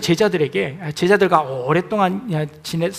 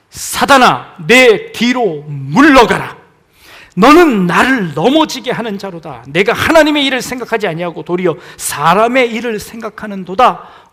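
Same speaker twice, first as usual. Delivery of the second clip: stern rebuke; 2 semitones higher, 11 dB louder than usual. Acted. Jesus turning on Peter, loud stern rebuke. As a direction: drive him back with authority.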